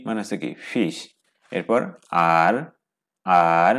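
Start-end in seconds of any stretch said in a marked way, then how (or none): 2.4-3.8 আর said as Chinese er, a curled r vowel; Mandarin er sound. Shown as a demonstration. Teacher models the Chinese er sound. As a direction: curl the tongue back on আর. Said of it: "আর মানে হচ্ছে কি ইয়ার".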